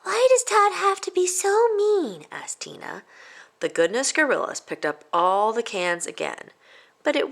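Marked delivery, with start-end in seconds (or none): 0.0-2.3 put-on high, slow, hurt kid's complaint; whiny child. Tina complaining to her friends about Todd. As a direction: ask it close to tears.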